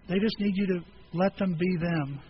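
ordinary delivery

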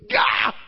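sharp high frustrated yelp; a strangled cry of frustration; exasperated gah. Retold or imitated. Grandpa after son hangs up, a high frustrated yelp.

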